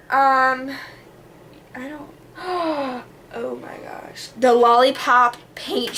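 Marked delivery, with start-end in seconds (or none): 1.7-4.3 sudden remembering. Real, not acted.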